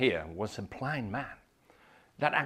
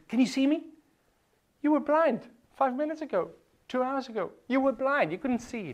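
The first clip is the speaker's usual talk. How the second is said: much higher, joyful questioning; excited voice of Jesus; 12 semitones higher, 3 dB louder than usual. Acted. Jesus speaking to the healed blind man, high and joyful. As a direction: marvel with him.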